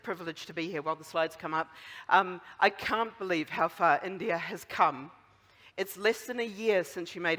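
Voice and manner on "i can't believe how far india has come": ordinary delivery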